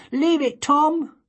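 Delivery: high and loud, ordering the cat off; little girl shouting at the cat to leave the mouse; sharp child's shout. Acted. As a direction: yell at the cat to drop it.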